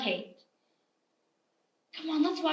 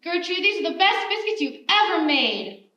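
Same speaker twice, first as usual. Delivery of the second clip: calling out across the picnic, a bit louder; mock yell; 4 semitones higher, 5 dB louder than usual. Sheriff calling across a church picnic to his wife, mild mock yell. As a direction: holler over to the wife, only half in earnest. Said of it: "he yelled over".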